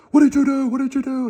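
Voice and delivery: high-pitched, loud, frantic mock caller voice; shrill it out like a caller caught out; panicky scammer. Scammer panics on phone at the caller, high frantic mock voice.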